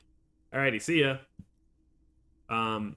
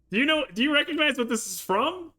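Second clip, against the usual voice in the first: ordinary talk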